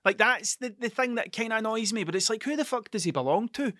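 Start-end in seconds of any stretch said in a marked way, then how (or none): none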